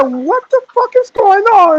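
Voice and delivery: high, loud mock outrage; exasperated sergeant. Sergeant baffled by the recruit's answers, high exasperated yell. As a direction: yell it in sheer disbelief.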